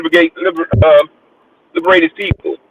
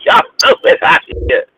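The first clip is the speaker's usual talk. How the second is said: retold retort. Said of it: "I said".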